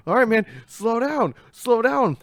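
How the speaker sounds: high, loud panicked plea; panicked yell. Retold or imitated. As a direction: plead with rising panic.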